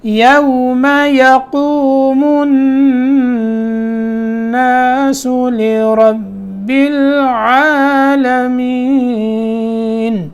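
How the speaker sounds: slow tajweed chant; slow recitation. Shown as a demonstration. Teacher reciting the verse again for students. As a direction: recite the full verse slowly. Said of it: "عین نکالنا ہے".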